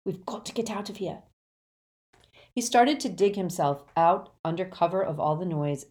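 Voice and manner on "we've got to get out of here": quick, hushed urgency; soft, hurried, furtive; whisper. A narrator's reading. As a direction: whisper fast so nobody hears. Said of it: "Harry whispered".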